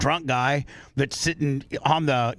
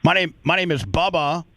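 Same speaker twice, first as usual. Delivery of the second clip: speaking up; 4 semitones higher than usual. Real, not acted. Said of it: "can you speak up".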